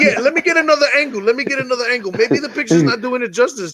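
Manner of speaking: high, wheedling sales pitch; pushy salesman. Retold or imitated. Pushing the cake on a reluctant mother like a salesman. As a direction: sell her the cake like a store clerk.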